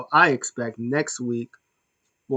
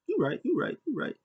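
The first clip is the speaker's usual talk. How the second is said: much higher, soft mock agreement; Roman agreeing; 12 semitones higher, 6 dB quieter than usual. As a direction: squeak out a lazy nod of approval. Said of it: "Roman's like".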